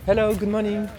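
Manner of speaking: loud, high, cheery hello to neighbours; calling out greetings. Real, not acted. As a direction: call across to the neighbours with a laugh.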